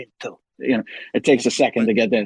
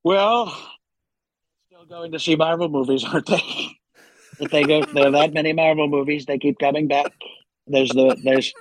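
Donald Trump. Acted. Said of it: "back to Trump".

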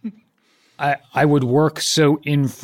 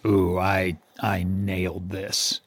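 smug; 7 semitones lower, 6 dB quieter, slower than usual. Retold or imitated. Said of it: "you were like".

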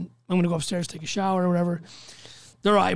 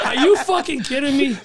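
high, loud incredulous laugh-talk; retold laughing reaction; laughing disbelief; 6 semitones higher, 5 dB louder than usual. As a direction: imitate someone cracking up as he asks. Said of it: "He starts fucking laughing".

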